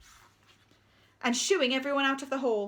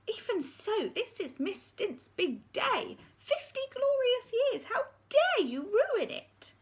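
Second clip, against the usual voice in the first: high, breathy appeal; secretary begging Miss Seeth; pleading secretary; 13 semitones higher, slower than usual. Acted. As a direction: plead with the raging deputy. Secretary protests.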